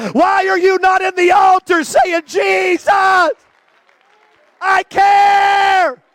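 loud, high fiery preaching; shouted altar call. Acted.

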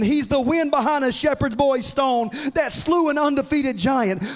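loud, rising litany of praise, building each line; full-force preaching. Acted. Preacher exalting Jesus to his congregation, loud and building. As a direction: preach it like a revival crescendo.

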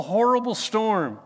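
usual speaking voice

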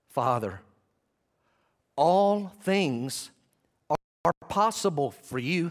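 slow appeal with long pauses; measured, spaced-out prayer; deliberate prayerful appeal. Acted. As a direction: set each phrase apart, voice lifting after the first word. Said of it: "calling out loudly".

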